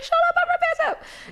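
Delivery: very high, squealed mock astonishment; excited disbelief. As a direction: retell it as a thrilled squeal. Retelling a surprised discovery.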